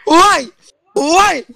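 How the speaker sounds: high, loud mock anguished cry; mock despair. Real, not acted. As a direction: wail it in playful despair.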